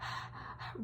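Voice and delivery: imitated creepy panting; quiet, voiceless excited breaths; excited breathing. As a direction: act out her eerie huffing. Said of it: "but she was like".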